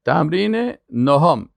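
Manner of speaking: ordinary talk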